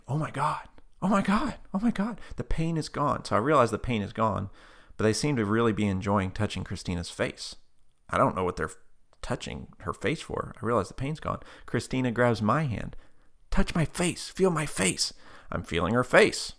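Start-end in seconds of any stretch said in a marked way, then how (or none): 0.1-3.2 high-pitched retold astonishment; amazed exclaiming. Retelling a woman finding her pain gone, amazed. 13.5-15.1 high, insistent retold plea; excited urging. Healed woman urging him to feel, excited.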